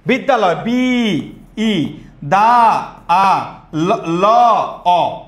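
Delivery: বিদ্যালয় taken apart, each syllable stretched, then its vowel alone; sounded out by vowels. Shown as a demonstration. Teacher shows each vowel makes a syllable, sounded out slowly. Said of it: "যতটা স্বরবর্ণ ততটা অক্ষর".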